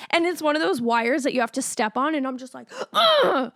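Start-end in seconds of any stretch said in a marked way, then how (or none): 2.9-3.5 grunt. Retold or imitated. Stamping on the wire.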